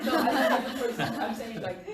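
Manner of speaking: loud laughing protest. Real, not acted. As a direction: talk through the laughter. Laughing while answering.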